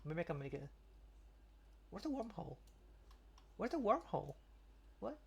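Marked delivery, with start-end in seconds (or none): none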